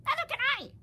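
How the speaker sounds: very high, loud howl; shrill loud cry. Acted. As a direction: wail in panic. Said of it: "howled Flitter".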